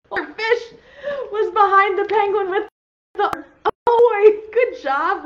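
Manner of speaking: high, loud, laughing cheer; excited praise. Real, not acted. Teacher celebrating the class's find, high and loud with laughter.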